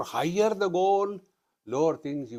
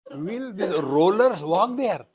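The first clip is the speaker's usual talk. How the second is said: louder, higher, mocking the question at the crowd; loud rhetorical challenge; 5 semitones higher, 6 dB louder than usual. Real, not acted. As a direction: throw it out loud as a taunt. Mocking who walks the ramp.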